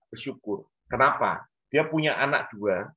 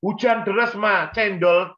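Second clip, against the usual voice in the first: put-on much higher, a bit louder complaining child; voiced child complaining; 7 semitones higher, 4 dB louder than usual. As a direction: whine it up high to Mom. Son complains to mother.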